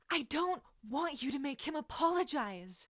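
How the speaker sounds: high, loud shout with unshed tears; girl shouts back at the boy defending her, loud and high. A narrator's reading. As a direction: fire it straight back at him, near tears. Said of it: "Lily shouted back".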